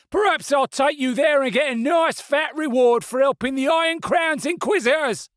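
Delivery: high, loud sneering threat; menacing stallholder. A narrator's reading. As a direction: taunt her with the reward. Stallholder taunts the woman.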